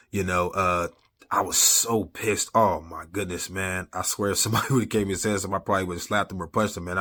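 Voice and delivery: ordinary talk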